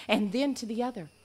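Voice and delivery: ordinary delivery, nothing marked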